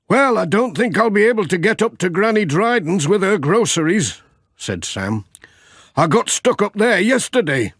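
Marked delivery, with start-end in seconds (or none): 0.1-4.2 high, friendly village shopkeeper; shopkeeper Sam. Acted. 6.0-7.7 high, friendly village shopkeeper; shopkeeper Sam. Acted.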